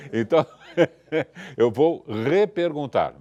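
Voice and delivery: laughing while talking. Real, not acted. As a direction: chuckle through the words.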